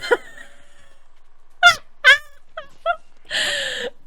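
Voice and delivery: very high wheezing cry-laugh. Real, not acted. Streamer laughing so hard she cries, high wheeze. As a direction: laugh till you cry.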